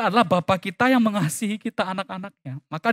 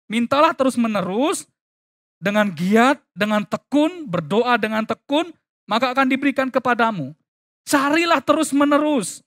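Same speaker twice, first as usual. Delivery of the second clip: raised, preaching recitation with pauses; emphatic scripture reading; 6 semitones higher, 3 dB louder than usual. Acted. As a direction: proclaim the verse phrase by phrase, pitched up.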